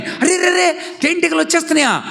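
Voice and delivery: high, quick startled exclamation; alarmed mother. Acted. Mother startled to hear bees are coming, alarmed. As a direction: gasp it out in alarm.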